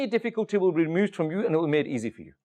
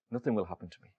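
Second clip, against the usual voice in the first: hushed, very low retold reply; soft, settled assurance; calm certainty; 12 semitones lower, 9 dB quieter, faster than usual. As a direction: drop right down, quietly sure. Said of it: "why are you so calm".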